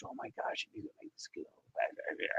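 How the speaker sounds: breathy rushed whisper. Real, not acted. Telling Debbie she must come, in a rushed whisper. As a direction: hush it like a secret hope. Aside to a viewer.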